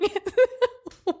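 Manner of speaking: real high-pitched giggle; laughing giggle. Real, not acted. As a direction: giggle breaking out. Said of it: "Why are you laughing?".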